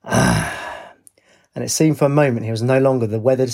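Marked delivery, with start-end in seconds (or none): 0.0-0.9 low, drawn-out exhale; satisfied "ah". A narrator's reading. He sips his pint, letting out a contented ah. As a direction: let out a long low sigh of relief. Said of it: "He let out an".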